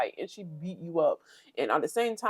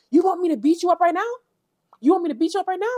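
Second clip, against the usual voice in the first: high, loud, fast mock threat; 12 semitones higher, 6 dB louder, much faster than usual. Imitating Slim threatening Summer, high and loud. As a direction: squawk it like a hothead. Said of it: "Slim's like".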